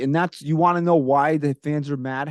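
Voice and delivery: ordinary delivery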